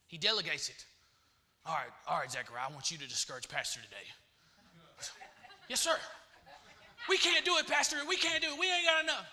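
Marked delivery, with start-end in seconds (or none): 1.6-4.1 low, quick, hushed command; Satan giving orders. Acted. Satan delegating to his demon. 5.7-9.3 high-pitched mock complainer; whining underling. Voices obedient discourager complaining.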